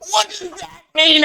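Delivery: high-pitched kobold squeal; panicked kobold. Acted. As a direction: squeal it like a baffled little creature. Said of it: "And the kobold goes".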